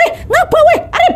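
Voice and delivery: high-pitched alarmed call; confused yell. Acted. Meli to fleeing Arif, confused high yell. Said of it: "langsung teriak kan".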